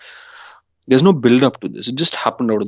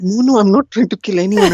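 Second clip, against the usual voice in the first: higher, put-on girlfriend voice; a friend calms her panicking girlfriend, pitched up and insistent; girlfriend reassuring; 7 semitones higher than usual. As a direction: soothe the paranoid friend in a higher voice. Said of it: "And she's like".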